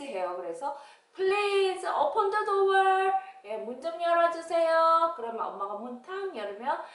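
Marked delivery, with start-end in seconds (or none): 1.1-5.1 high, loud singsong request; polite plea. Acted. Child asking mom to open up, polite singsong plea. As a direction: plead in a long singing tone. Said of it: "공손하게 말할 때".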